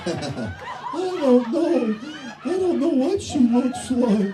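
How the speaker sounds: high, slow whiny wail; mock crying boy. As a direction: blubber like a lost kid. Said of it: "How the fuck is he supposed to say".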